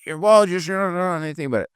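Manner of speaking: a touch of a huffy objection; mock defensive prospect. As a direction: play the prospect snapping back defensively.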